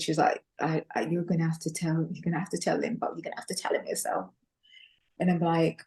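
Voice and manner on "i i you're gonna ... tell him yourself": frightened mother, whispered and softer; imitating a nervous mother's whisper; scared whisper. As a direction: hush it like you fear being overheard. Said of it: "she was so scared".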